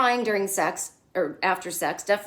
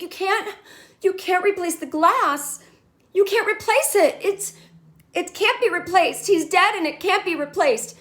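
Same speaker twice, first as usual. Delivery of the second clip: high, loud mocking panic; hammed-up meltdown over a photo; imitating Carrie losing it at Seema; freaking out; 11 semitones higher, 5 dB louder than usual. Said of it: "freaking out on this woman".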